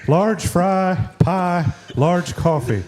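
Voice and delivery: cartoon neighbor placing an order; Mister Anderson. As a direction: order in a cartoon old-timer's voice. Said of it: "when I heard that voice".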